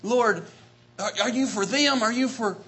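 ordinary talk

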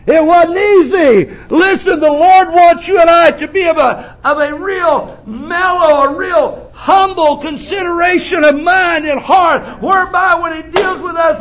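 high, ringing preacher's cry; preaching at full force. Acted. Preacher tells the church of his mother, full-force preaching. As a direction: preach it out to the congregation.

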